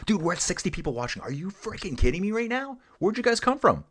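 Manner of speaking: high-pitched, fast excited outburst; can't believe how many joined, pitch shooting up; excited disbelief. Real, not acted. Viewer count suddenly jumps.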